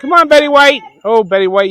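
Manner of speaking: high, loud call to an animal. Real, not acted. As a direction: shout her over warmly. Calling to an animal.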